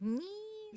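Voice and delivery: high, soft drill squeal; thin rising-pitched dental drill noise, held out; imitated drill whine. Podcaster mimics Naomi's dentist drill starting up, high whine. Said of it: "her dentist drill and".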